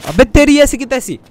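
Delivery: high, loud shocked cry; outraged outburst. Real, not acted. Gamer shocked when she spits on Michael, loud outraged cry. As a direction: blurt it out in disbelief.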